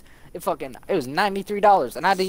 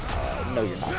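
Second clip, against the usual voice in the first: much lower, deadpan voice; lowered mock voice of the game; game talking back; 6 dB quieter than usual. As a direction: answer like a smug game narrator. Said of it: "and then it's like".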